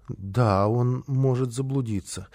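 low, soft, uneasy agreement; worried. A narrator's reading. Boy catching the housekeeper's anxiety, worried. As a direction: say it quietly, troubled. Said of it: "Малышу передалась её тревога".

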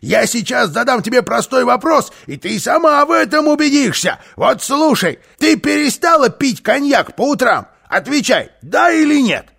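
high, loud, cocky interrogation; loud challenge. Acted. Karlsson putting a trick question to housekeeper, loud challenge. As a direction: fire the question at full voice.

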